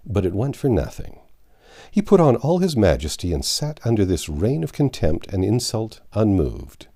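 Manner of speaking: ordinary delivery, nothing marked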